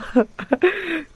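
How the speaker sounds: a burst of high incredulous laughter; high shocked laugh. Real, not acted. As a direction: laugh in disbelief at the twist. Laugh at the twist.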